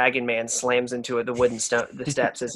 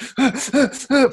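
a high startled gasp; mock gasp; 10 semitones higher, 4 dB louder than usual. Paramar looking up the stairs, startled gasp. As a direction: gasp in alarm. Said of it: "you're like".

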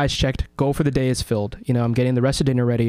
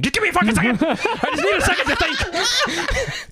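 high, strained, laughing through it; frantic plea. Retold or imitated. Burned cook to helper, frantic high plea. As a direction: beg for a second, overwhelmed.

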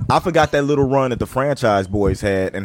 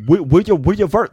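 yelling; 6 semitones higher, 4 dB louder than usual. Retold or imitated. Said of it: "[name]'s yelling".

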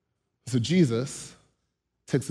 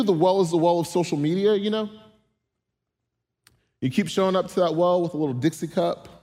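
normal talking voice

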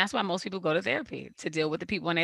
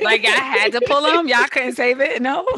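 loud, higher mock outrage; indignant kid; 5 semitones higher, 8 dB louder than usual. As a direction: complain like a wronged kid. Voicing the girl, indignant.

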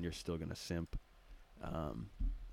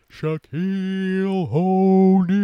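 announcer voice; 9 semitones higher, 11 dB louder, much slower than usual. Acted. Announcer-style name reveal.

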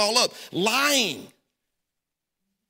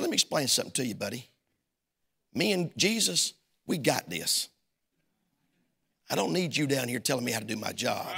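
firm low rebuke; 8 semitones lower, 7 dB quieter than usual. Retold or imitated. Pastor rebukes man in office.

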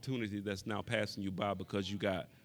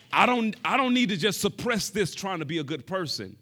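preaching at full force; 8 semitones higher, 10 dB louder than usual. Real, not acted. Preaching climax.

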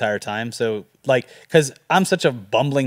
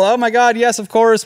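excited yes; 8 semitones higher, 4 dB louder than usual. Retold or imitated. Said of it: "She was like".

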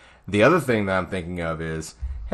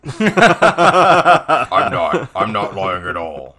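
laughing denial; 9 dB louder, much slower than usual. Retold or imitated. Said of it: "I was like".

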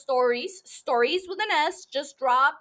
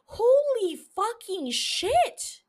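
slow, stretched and higher, rising in awe; higher awed exclamation; 8 semitones higher, much slower than usual. Real, not acted. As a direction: climb up in giddy disbelief. Raving about loved show.

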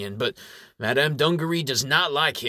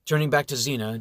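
ordinary delivery

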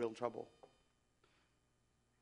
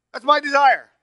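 full-force preaching; 9 semitones higher, 15 dB louder than usual. Acted. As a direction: pour out Paul's words to the congregation at full force. Preaching the verse to the congregation.

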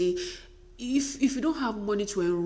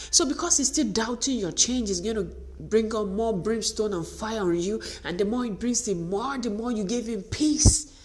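usual speaking voice

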